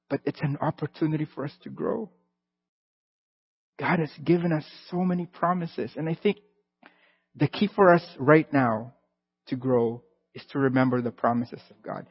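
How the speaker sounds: ordinary delivery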